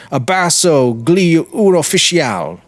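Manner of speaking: a man in a wine shop jeers at passing officers, calling out; called out from a wine shop. Retold or imitated. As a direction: shout it across the street at the officers. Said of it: "someone called out".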